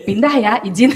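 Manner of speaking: normal talking voice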